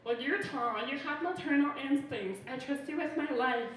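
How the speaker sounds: loud, insistent imitation of gushing people; mock trusting admirer. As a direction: gush loudly like someone handing over trust. Imitating people who assume she mothers.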